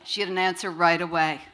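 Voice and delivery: usual speaking voice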